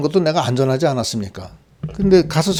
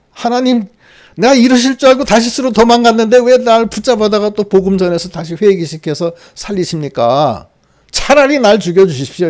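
higher, pleading outcry at God; raised voice of bitter complaint; angry protest; 4 semitones higher than usual. Acted. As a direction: Jonah fed up, demanding to die. Jonah crying out angrily to God.

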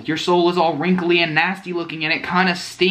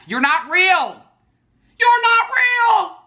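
shrieked high and slow, building louder; hammed-up terror at the AI; mock panic; 5 dB louder, much slower than usual. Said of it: "I'm a little scared".

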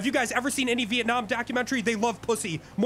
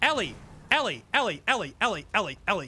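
insistent name-calling; 4 semitones lower, faster than usual. Real, not acted. Repeatedly calling teammate's name.

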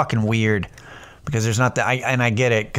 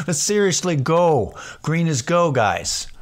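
higher, insistent cop voice; mock traffic cop; 6 semitones higher than usual. Cop urging drivers through a green light, mock exasperated. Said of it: "put cops to go".

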